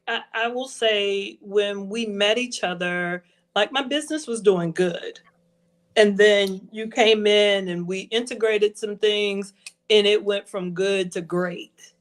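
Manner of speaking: normal talking voice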